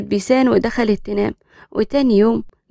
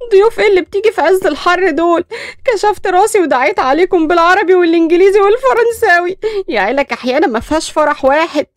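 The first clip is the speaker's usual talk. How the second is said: much higher, louder mock tirade; comic rant; 7 semitones higher, 4 dB louder than usual. Daughter ranting at her mother about the guests, hammed-up tirade.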